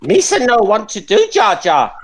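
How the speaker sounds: whispered Jar Jar impression, high; mock Jar Jar. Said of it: "He's New Zealand".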